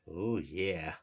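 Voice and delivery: ordinary delivery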